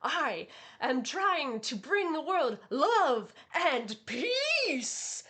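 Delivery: slow, with peace soaring very high; earnest love and peace. Retold or imitated. Vash declaring his mission, slow with a high peace. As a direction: drag it out then fling peace way up. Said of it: "he's just like".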